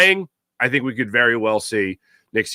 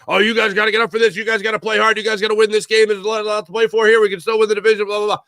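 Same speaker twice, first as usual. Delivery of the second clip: a higher, lightly mocking pep-talk voice; mocking the coach; 9 semitones higher, faster than usual. The host mocking the coach's pregame speech to his players, higher and sarcastic. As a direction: parody the coach firing up the team.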